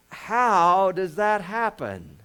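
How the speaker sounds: slow, loud rhetorical question; slow loud preacher's question; drawn-out emphasis. Real, not acted. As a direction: stretch every word for the congregation.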